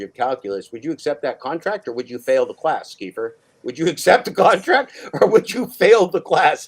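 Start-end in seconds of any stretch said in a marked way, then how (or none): 3.6-6.7 louder, insistent. Acted. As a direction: repeat it higher and louder, slowing on fail the class. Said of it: "repeats the question louder".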